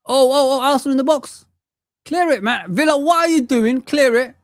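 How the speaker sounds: high, alarmed football-fan outburst; real panic watching the box; urgent match reaction. Real, not acted. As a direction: call out at the players, pitch jumping up. Reacting live to match on screen.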